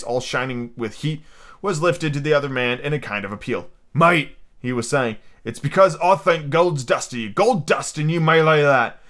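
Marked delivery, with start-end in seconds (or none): 3.9-4.4 lightly voiced pirate making an appeal; higher, louder appeal to a shipmate; Long John Silver, appealing. 5.4-9.0 higher, pressing pirate voice; pirate pleading earnestly. Acted.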